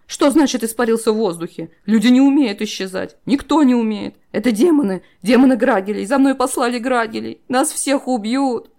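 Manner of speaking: loud high panicked voice; rising hysteria. A narrator's reading. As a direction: spiral into panic. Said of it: "начинающуюся истерику".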